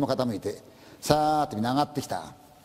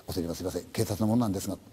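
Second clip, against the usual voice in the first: softer, lower, reluctant officer's voice; hesitant policeman; 7 semitones lower, 4 dB quieter, faster than usual. Acted. Policeman at the dark entrance, soft and hesitant. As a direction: tread carefully, you bring bad news.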